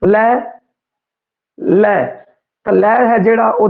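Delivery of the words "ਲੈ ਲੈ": ਲੈ said twice, clearly, the second one falling; sound demo. Shown as a demonstration. Teacher showing students the tongue on ਲੈ. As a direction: repeat ਲੈ so the tongue is visible. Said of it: "ਤੁਸੀਂ ਸਪਸ਼ਟ ਦੇਖ ਰਹੇ ਹੋਗੇ".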